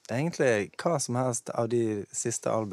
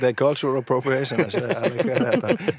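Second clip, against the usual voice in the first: laughing tease; 4 semitones higher, 8 dB louder than usual. Real, not acted. Teasing joke about appropriation.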